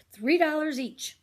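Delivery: slow, loud price call; confident kid seller. Acted. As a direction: announce the price proudly. Simon states price.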